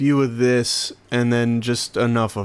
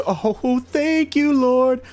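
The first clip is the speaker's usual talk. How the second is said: thankful; 11 semitones higher, much slower than usual. Real, not acted. Relief praise at a drop.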